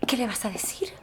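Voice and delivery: shaky, nervous whisper; trembling. Acted. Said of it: "estoy temblando".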